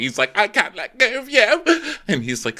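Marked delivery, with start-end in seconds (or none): 0.4-1.6 high, whimpering cry; hammed-up tearful falsetto; mock sobbing. Kyle being hugged tight by Natasha, high mock sobbing. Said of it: "he's, like, sobbing".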